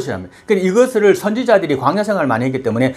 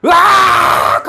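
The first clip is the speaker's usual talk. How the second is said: high, drawn-out mock shriek; imitated sudden scream; 5 dB louder, much slower than usual. Preacher acts out the medium suddenly seeing Samuel, imitated scream. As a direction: burst into a hammed-up "aaagh". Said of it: "소리 지릅니다".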